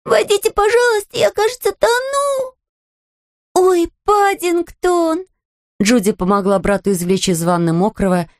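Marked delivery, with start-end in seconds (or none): 0.0-2.5 high bear voice, panicked; panicked little bear. Acted. Drowning bear calls out. 3.5-5.2 high, slow dismayed cry. Acted. Judy finds bear.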